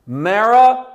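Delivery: the name Marah called out loud and high; emphatic name. Shown as a demonstration. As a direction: call out "Marah" like a herald. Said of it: "three times in one sen-, in, in one verse".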